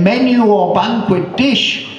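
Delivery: high, pushy waiter's question; eager waiter. Acted. Waiter asks again.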